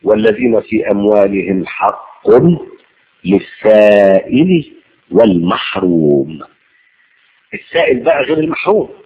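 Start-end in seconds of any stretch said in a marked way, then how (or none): 0.0-6.4 slow Quranic recitation. Acted.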